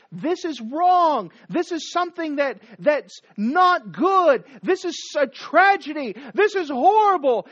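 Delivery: loud, high preacher's outcry; imitated public mourning. Preacher acts out Mordecai mourning in public, a loud lamenting outcry. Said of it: "publicly mourning and weeping and say".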